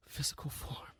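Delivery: hushed awed whisper. Acted. Jeremy alone in the flat, whispering the realization. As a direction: breathe the words in dawning realization.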